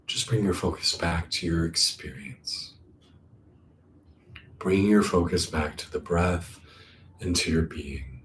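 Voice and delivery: unhurried, calming meditation guidance; guided meditation. Acted. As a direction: ease them back to the breath. Guides back to the breath.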